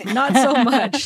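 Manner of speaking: laughing through the words. Real, not acted. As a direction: chuckle it out, amused at yourself. Self-mocking joke about being scientific.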